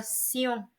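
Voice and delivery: "sillon" as see-on, nasal ending. Shown as a demonstration. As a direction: say "sillon" softly, an s gliding into on. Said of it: "it's kind of like softer".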